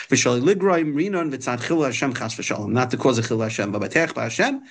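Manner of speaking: ordinary delivery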